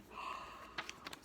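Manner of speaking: a breathy surprised gasp; soft gasp. Real, not acted. Teacher reacting to Toad's plan, a soft gasp.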